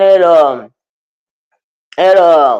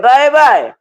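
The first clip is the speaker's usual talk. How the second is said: put-on exclaimed baby; 5 semitones higher, much slower than usual. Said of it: "I said".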